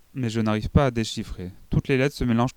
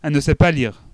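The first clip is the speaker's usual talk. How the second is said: high, loud outburst; crying out; 6 semitones higher, 8 dB louder than usual. A narrator's reading. Girl crying out to her teacher that mother cannot read. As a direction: blurt it out, unable to hold back. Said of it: "je ne peux pas m'empêcher de crier".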